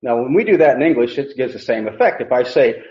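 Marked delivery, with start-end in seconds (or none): none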